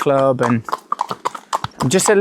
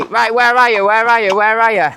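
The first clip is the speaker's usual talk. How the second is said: impatient caller; 7 semitones higher than usual. Retold or imitated. Nick ringing the late jockey, impatient and insistent. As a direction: hammer the question like a boss losing patience.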